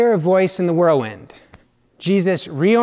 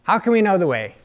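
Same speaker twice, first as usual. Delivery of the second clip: higher, louder and fast, a sudden outburst; blurting out; 6 semitones higher, 5 dB louder, faster than usual. Acted. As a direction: blurt it out in a rush. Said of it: "he blurts out".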